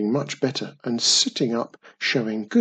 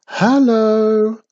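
higher, louder bright call; cheeriest voice; 10 semitones higher, 9 dB louder than usual. Acted. Wizardy Witch greets goblin, cheerful call. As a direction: sing out a friendly hello. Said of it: "in her cheeriest voice".

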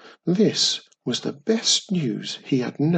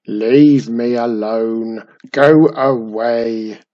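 loud, slow grumbling goblin from the dark; grumpy goblin groan; 9 dB louder, slower than usual. Acted. Hidden goblin drives visitors off, grumpy groan. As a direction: bellow it like an old grouch wanting peace. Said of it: "a voice groaned grumpily".

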